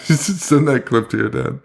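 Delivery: joking through giggles; ribbing a friend, laughing; laughing tease. Real, not acted. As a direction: tease him while you laugh.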